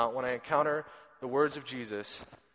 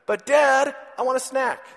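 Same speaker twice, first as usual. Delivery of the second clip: put-on much higher, louder little-boy plea; whiny toddler; 10 semitones higher, 8 dB louder than usual. Father imitating his son begging for a snack, high whine. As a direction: whine for a snack like a small child. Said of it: "he says".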